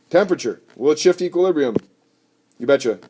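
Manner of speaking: ordinary talk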